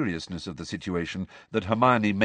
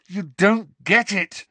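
high, slow, emphatic shout; loud, drawn-out exasperation; angry shout; 12 semitones higher, 6 dB louder, slower than usual. A narrator's reading. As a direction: shout it in frustration. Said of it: "Harry shouted at her".

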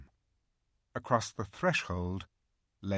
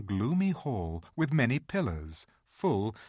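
usual speaking voice

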